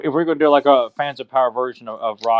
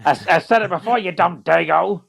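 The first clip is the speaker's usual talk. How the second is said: gruff trainer voice; 5 semitones higher than usual. Retold or imitated. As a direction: growl it like a boxing coach. Acting out Mick insulting Rocky.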